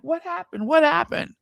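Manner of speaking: high, alarmed retelling; retold panic. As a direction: re-enact panicked questions to a screaming caller. Retells own panicked phone reaction.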